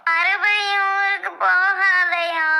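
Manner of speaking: slow, drawn-out crying echo; long, whining sob of disbelief; tearful wail. Acted. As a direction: wail the words back in protest. Wife wails back at husband's consolation.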